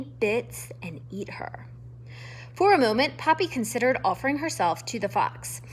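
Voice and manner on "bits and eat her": hushed whisper of dread. A narrator's reading. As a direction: whisper the grim end.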